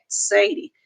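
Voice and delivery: plain reading voice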